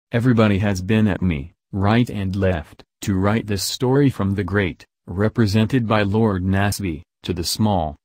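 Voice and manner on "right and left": ordinary delivery, nothing marked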